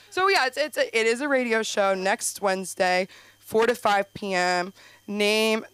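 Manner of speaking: ordinary delivery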